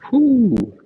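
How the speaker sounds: high, loud exhaled whew of relief; loud relieved breath out. Real, not acted. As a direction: blow out the tiredness. Exhale after long counting.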